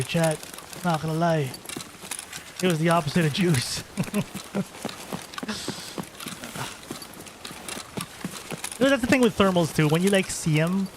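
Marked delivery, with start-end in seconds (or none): 3.9-7.0 long breathless laugh. Real, not acted. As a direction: laugh silently at the joke. Laughs at his own joke.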